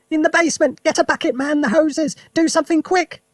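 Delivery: put-on high, loud old woman's yell; shrill high alarm shout from grandma. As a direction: scream the word in panic. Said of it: "the old woman yelled".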